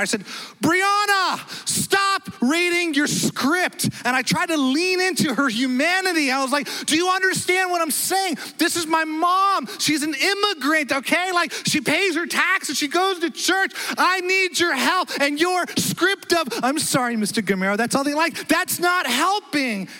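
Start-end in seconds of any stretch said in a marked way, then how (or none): 0.6-3.9 retold frustrated yell at the rep; flipped out. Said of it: "I flipped out on her". 6.9-19.9 high, heated retold rant; exasperated son yelling at the bank rep; flipped out. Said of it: "I flipped out on her".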